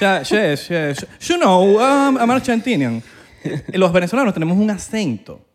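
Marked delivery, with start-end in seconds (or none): 0.0-3.0 high, loud mock Argentinian English; exaggerated Argentinian accent, sh for y. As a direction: ham up an Argentinian speaking English. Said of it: "o los argentinos".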